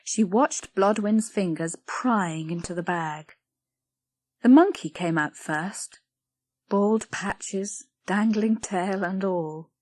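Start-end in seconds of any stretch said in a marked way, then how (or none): none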